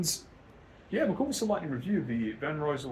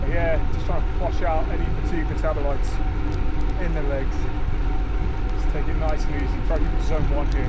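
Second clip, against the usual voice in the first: talking loudly while riding; 3 semitones higher, 9 dB louder than usual. Real, not acted.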